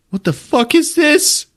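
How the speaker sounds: high, slow disbelieving cry; shocked character voice. Retold or imitated. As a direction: squeal it slowly in mock shock. Retold shocked reaction to gore.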